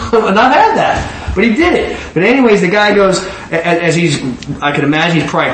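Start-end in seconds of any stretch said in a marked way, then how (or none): 0.0-2.0 laughing. Real, not acted. Laughs after mock fear.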